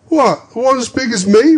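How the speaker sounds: put-on high, loud boy's voice; a surprised urchin; startled young lad; street boy. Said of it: "returned the boy".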